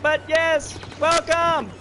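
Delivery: beam out a welcome to a returning viewer; excited greeting. Real, not acted. Greeting chatter Sandra excitedly.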